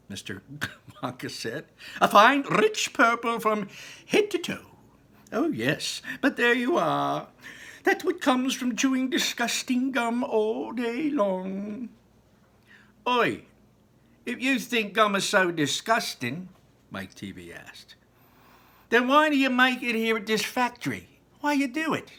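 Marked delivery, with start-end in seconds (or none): 2.0-11.9 high, quick and fussy chocolatier voice; a bright eccentric host, much higher than the narrator; excited Mister Wonka. A narrator's reading. Wonka telling the children what happens to gum chewers, high and fussy. Wonka scolds about Violet. 13.1-22.1 put-on high, loud and brash kid calling out; a pushy know-it-all boy; cheeky boy. A narrator's reading. Mike TV challenging Mister Wonka, brash and loud.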